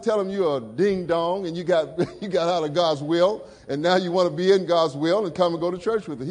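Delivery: high, loud, chuckling retelling; a touch of laughing scolding; retold teasing advice. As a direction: tease him like an old friend. Pastor retells his own advice.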